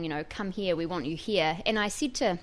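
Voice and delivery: usual speaking voice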